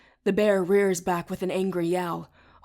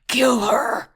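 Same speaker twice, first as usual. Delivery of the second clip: high, loud roared command; demon roar; 5 semitones higher, 8 dB louder than usual. A narrator's reading. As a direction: roar the kill order at the horde. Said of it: "he roars".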